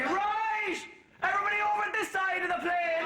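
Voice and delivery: high rowdy call to the plane; rally the drunks, pitched high; drunken shout. Retold or imitated. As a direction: drunk Irishman rousing the cabin.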